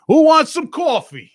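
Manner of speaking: high, slow Brooklyn bellow of "coffee" as "cawfee"; loud stretched Brooklyn "coffee" with open "caw"; New York accent. Shown as a demonstration. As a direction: belt it like a New York diner guy, "cawfee" drawn out. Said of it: "do it like Vic would do it".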